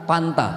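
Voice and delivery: "Panta" with a hard P instead of F; Sundanese F as P. Retold or imitated. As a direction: say "Panta", turning the F into P. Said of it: "agak susah ngucapin huruf fa".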